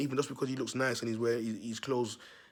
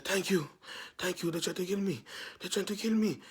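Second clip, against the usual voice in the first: higher, winded pleading; imitated breathlessness; 5 semitones higher than usual. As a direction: act out a fugitive catching his breath. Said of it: "he's out of breath".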